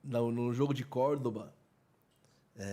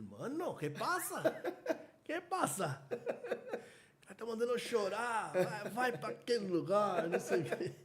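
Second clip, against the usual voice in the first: higher-pitched comic outrage, laugh creeping in; mock indignant fan; 7 semitones higher than usual. Furious São Paulo fan cursing the vendor, mock indignant. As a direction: imitate an angry fan protesting, voice jumping up. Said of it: "começou a xingar o cara".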